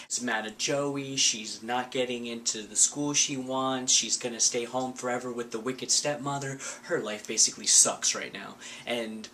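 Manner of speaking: ordinary talk, nothing marked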